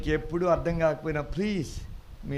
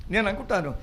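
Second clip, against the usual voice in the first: ordinary talk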